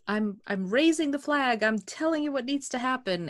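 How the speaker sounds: imitated exasperated insistence; insistent warning. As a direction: act out a frustrated high-pitched warning. Acting out an unheeded warning.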